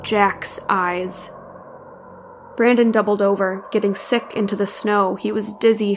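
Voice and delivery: ordinary delivery, nothing marked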